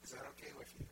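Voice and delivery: soft, near-whispered question; gentle asking. Acted. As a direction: drop down and ask tenderly. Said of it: "He says".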